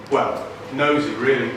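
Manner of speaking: normal talking voice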